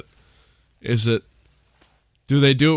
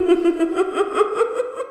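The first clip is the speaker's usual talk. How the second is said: high giggle. Real, not acted. Giggle after jumpscare.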